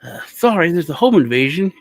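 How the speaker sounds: high, apologetic phone report; mock polite police call. Grandpa calls police about nice intruders, high polite voice. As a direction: report intruders as nicely as possible. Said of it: "it's like".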